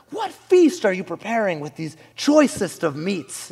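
loud, high, grandiose king voice; old Persian king. Acted. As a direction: proclaim it like royalty in a made-up accent. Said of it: "They'd walk into your fridge and be like".

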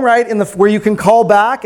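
normal talking voice

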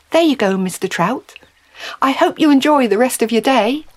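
kindly woman wishing a creature well; lifted, cheery farewell to a fish; kindly Mary. Acted.

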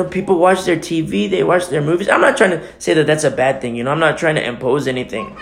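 ordinary talk

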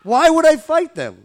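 high, loud disbelief; why would I, really?; incredulous. Real, not acted. Indignant reply.

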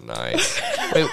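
normal talking voice

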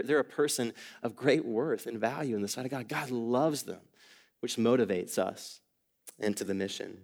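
usual speaking voice

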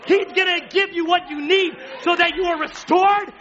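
loud, high preacher's shout; full-force preaching. Acted. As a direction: drive the point home to the congregation.

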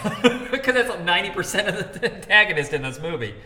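high, loud, laughing delivery; breaking up with laughter mid-sentence. Real, not acted. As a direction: laugh your way through the explanation. Laughing after own warning.

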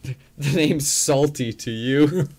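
slow, loud tough-guy line; tough-guy drawl. Pretend. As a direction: say it slow like a hard man. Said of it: "You're like".

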